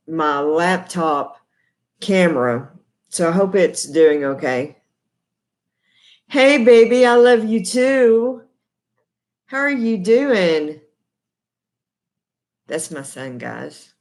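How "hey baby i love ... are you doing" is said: sweet greeting to son. Real, not acted. Greeting her son on camera.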